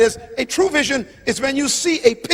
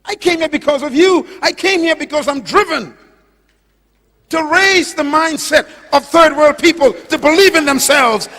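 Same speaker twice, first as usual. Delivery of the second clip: higher, loud impassioned preacher's shout; full-force preaching; 7 semitones higher, 8 dB louder than usual. Real, not acted. Preacher tells the audience why he came, full-force preaching. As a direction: build to a ringing declaration.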